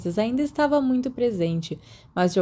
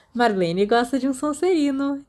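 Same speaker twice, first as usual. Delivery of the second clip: mischievous; 6 semitones higher than usual. A narrator's reading. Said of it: "disse Mary maliciosamente".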